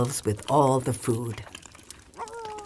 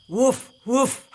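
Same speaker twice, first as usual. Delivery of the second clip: higher cartoon woof; dog barking; 10 semitones higher than usual. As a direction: bark up at the tree. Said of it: "the dog looked up and barked".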